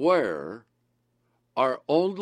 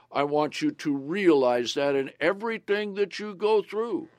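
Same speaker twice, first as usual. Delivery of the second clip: normal talking voice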